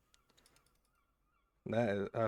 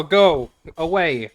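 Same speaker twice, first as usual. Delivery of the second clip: slow, loud, high dismissal with go punched; yelling at the rambling uncle to leave; loud go away; 4 semitones higher, 12 dB louder, slower than usual. Real, not acted. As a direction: bark him off, stretching it out. Dismissing the NPC.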